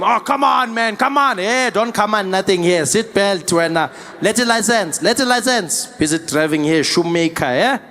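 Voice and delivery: animated driver haggling with police; arguing South African driver. Acted. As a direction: protest to the officer in a South African accent. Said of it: "you're in the game".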